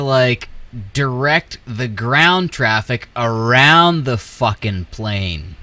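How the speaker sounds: normal talking voice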